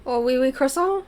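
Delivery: put-on high, silly French accent; joking stock-phrase French accent; mock French accent. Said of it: "You got to do the French accent?".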